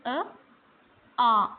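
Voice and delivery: short अ clipped, then आ drawn out long; teacher contrasting अ and आ. Shown as a demonstration. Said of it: "देखो मैंने अलग-अलग pronounce कर रही हूँ".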